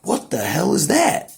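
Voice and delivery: high, baffled imitated exclamation; bewildered. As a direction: pitch up high, lost and baffled. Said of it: "sit there and be like".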